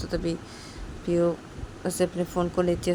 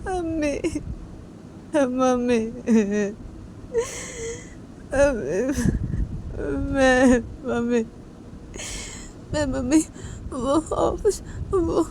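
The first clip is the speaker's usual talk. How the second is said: put-on high, halting sobbing child's voice; crying little girl; 7 semitones higher, slower than usual. Little daughter to her mother, sobbing and halting. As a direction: choke out the words through tears. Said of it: "रोते रोते".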